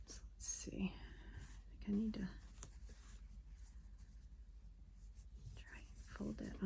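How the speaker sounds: soft unvoiced whisper while concentrating. Real, not acted. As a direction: breathe the words out under your breath.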